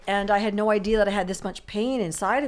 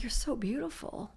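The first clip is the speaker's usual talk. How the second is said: tender awe; 5 semitones higher, 7 dB quieter than usual. Retold or imitated. Adoring the inner child in meditation.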